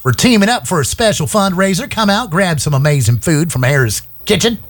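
radio ad read in a high, put-on Southern accent; high hammed-up Southern accent for a community event ad. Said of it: "I wanna do it all in the Southern accent".

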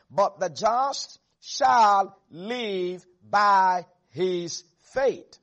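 very slow, deliberate scripture reading, weight on every word; slow, emphatic reading. Real, not acted. Preacher reads key verse to congregation, slow and emphatic. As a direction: hammer each word out slowly.

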